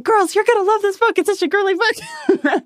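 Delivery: high, squealing impression of the guys gushing; teasing the male staff with a squeaky, excited, girly gush; mock girly voice. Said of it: "and so they're like".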